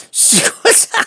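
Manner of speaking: squeaked out through laughter; high breathless giggle; laughing. Real, not acted. Laughing to the viewers about the stranger's legs, squeaked out. Cracking up recalling the man.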